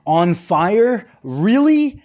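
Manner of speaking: drawn-out disbelief, jumping high at the end; incredulous. Real, not acted. Host still stuck on an earlier remark, incredulous. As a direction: question it slowly, then pitch up sharply.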